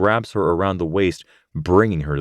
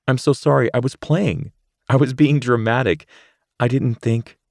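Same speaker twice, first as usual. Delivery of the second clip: put-on higher girl's voice, earnest and rushed; Rachel apologising; 6 semitones higher than usual. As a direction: she hugs him and explains. Rachel apologises, hugging Zane.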